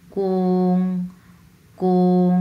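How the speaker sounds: কোং held on one level pitch; flat first tone. Shown as a demonstration. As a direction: say কোং long and level, no rise or fall. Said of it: "একবারে flat, সমতলভাবে".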